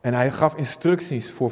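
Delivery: ordinary delivery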